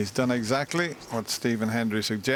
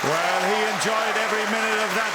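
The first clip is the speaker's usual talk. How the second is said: excited commentary; 10 semitones higher than usual. Real, not acted. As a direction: celebrate the break with full voice. Acclaiming the 131 break.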